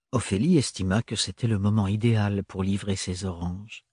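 usual speaking voice

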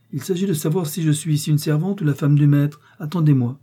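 insistent, high-pitched assertion of rank; firm. A narrator's reading. Wife insisting she is no servant here, firm. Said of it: "répliqua fermement Geneviève".

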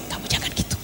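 hushed fatherly whisper. Acted. Father quietly correcting the child, whispered. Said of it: "bapaknya yang membisiki".

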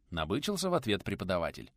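ordinary delivery

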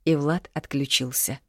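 ordinary delivery

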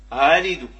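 آلد loud, second hamza halfway to alif; half-formed second hamza gliding in آلد; eased second hamza. Shown as a demonstration. Teacher repeating the tashil model, آلد with second hamza eased. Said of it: "هو التسهيل".

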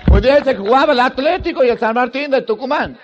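ordinary delivery

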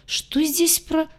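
high, slow, cut-off surprise; startled question. Acted. Laen peeks out, startled and cut off. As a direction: caught off guard mid-word.

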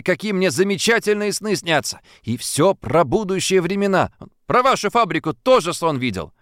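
put-on man keen to impress a girl; eager boast. A narrator's reading. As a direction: sell himself as a storyteller. Antoshin eagerly offers dreams.

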